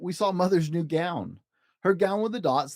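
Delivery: ordinary delivery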